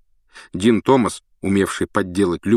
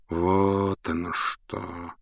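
soft thoughtful; 7 dB quieter, much slower than usual. A narrator's reading. Lupin, thoughtful.

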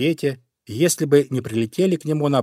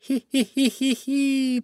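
high slow sneering giggle; sly fox giggle; 13 semitones higher, slower than usual. Acted. Fox laughs at the caught bunny, a high slow giggle. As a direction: giggle over the trapped bunny. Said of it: "идёт и смеётся".

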